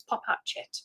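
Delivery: breathy whisper to herself; whispered aside. Real, not acted. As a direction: mutter the instruction under her breath. Muttering to herself at the screen.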